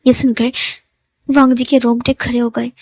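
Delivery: ordinary delivery, nothing marked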